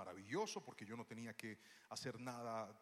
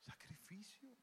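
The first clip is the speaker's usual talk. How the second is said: whispered, drawn-out sacrificio; whispered key word; whispered emphasis; 7 semitones higher, 12 dB quieter, slower than usual. Acted. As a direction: hush it for effect.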